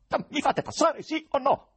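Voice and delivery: urlo acuto e forte; urlo terribile. A narrator's reading. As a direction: esigi strada a squarciagola. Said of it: "urlò il poeta con voce terribile". (urlato, minaccioso)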